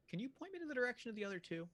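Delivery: high, fast eager ask; high quick hopeful plea; eager question. Acted. Character asks, high and fast.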